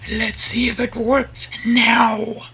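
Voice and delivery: very high, slow put-on voice with some force; high test voice. Testing high voice, then usual one.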